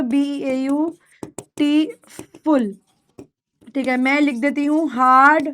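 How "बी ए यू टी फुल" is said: बी ए यू, टी, फुल with clear gaps between the parts; softer, piece-by-piece बी ए यू, टी, फुल, as if writing it out; broken into syllables. Shown as a demonstration. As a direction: break it up slowly as बी ए यू... टी... फुल. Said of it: "अगर मैं इसको तोड़ दूं".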